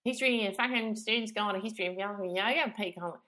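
fast, broad Australian accent, words slurred together; a quick, jokey broad Australian version of "History and fucking Student's Guide to History of Yoga". Shown as a demonstration. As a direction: rattle off the title in a mock Aussie accent. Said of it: "That's my Australian version".

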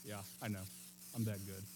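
low, soft cocky brag to the guard; smug aside. Retold or imitated. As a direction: play it cool and pleased with himself. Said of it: "looks over at the guard like".

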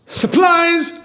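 high-pitched surprise shout; sudden shout. Acted. Chinese survivor jumping out in a joke, high sudden shout. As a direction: leap out and yell it, high. Joke punchline, man jumps out.

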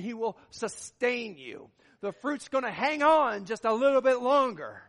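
forceful sermon delivery, drawing out key words; impassioned preaching. Real, not acted. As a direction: preach it to the whole room, hammering each promise.

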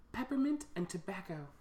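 put-on playful stab at a British accent. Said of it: "Is that your British accent?".

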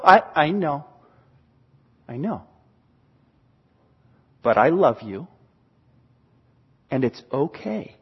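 low, soft, tender voice; quietly soothe, pausing between phrases; reassuring parent. Acted. Parent telling a child there are no consequences, reassuring.

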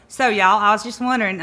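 put-on hams up a country accent while laughing; mock local accent. Speaker mimics the co-host's county accent, lightly teasing. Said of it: "Don't try to do the accent".

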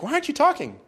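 high, puzzled wife's question. Acted. Elizabeth asking her silent husband, high and puzzled. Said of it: "She's like".

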